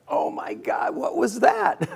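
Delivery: breathless whisper jumping up high on what was that; startled. Retold or imitated. Just shocked for the first time, a startled gasping question. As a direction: caught off guard by a jolt.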